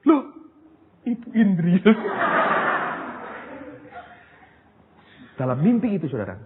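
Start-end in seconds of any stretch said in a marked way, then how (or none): 1.9-4.0 high breathy burst of laughter; amused laugh at the twist; real laugh. Real, not acted. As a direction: laugh at the surprise. Laughs at dream reveal.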